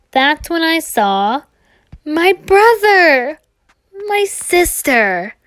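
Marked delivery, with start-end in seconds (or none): 2.1-5.4 slow, higher and louder, overcome at the sight; drawn out in disbelief at seeing family again; joyful recognition. Acted. Reunion with long-lost family.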